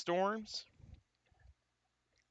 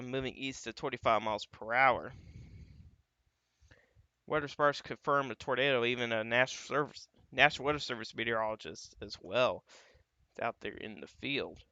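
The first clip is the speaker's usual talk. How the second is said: ordinary delivery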